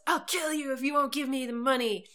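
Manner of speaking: half-yelled threat in retelling; mock threatening yell. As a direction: imitate a yelled threat without full force. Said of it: "he yells".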